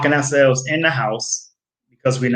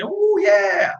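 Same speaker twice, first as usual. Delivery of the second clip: a much higher, playful "oh yeah"; a hammed-up cheer of excitement; mock excitement; 14 semitones higher than usual. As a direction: squeal it like a thrill. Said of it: "It's okay to have some excitement".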